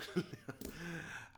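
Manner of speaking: soft, stifled laugh. Real, not acted. Stuck on whom to sleep with, soft laugh. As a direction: laugh quietly instead of answering. Embarrassed pause before answering.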